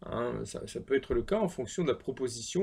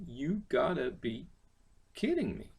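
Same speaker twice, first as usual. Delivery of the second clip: slow demo. Shown as a demonstration. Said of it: "slow demo of phrase".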